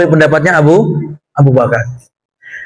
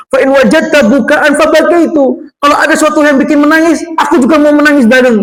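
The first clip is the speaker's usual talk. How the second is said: Umar earnest offer; 6 semitones higher than usual. Acted. Voicing Umar offering to cry.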